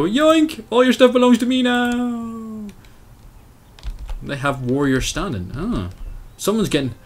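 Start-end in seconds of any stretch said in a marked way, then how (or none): 0.0-2.7 high, slow gloating yoink; snatch the loot with glee; cartoon thief gloating as he grabs it; gleeful raider cry. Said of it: "so I could go in and just go".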